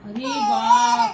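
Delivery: cooing cheer; delighted praise. Real, not acted. Mother delights in baby's eating.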